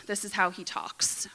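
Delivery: plain reading voice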